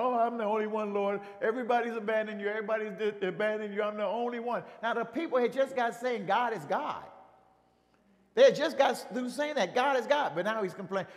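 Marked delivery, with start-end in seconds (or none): 0.0-4.6 mock crying. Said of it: "he's crying over there". 4.8-10.3 preaching. Real, not acted. Lines are louder.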